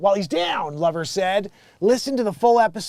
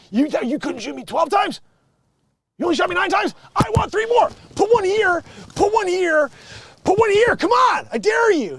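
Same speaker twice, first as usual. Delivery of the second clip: much higher, louder escalating dare; mock dare yelling; 13 semitones higher, 7 dB louder than usual. Rapper demanding three more shots, shouting dare. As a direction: scream for more bullets, building to a shout. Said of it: "That dude's like".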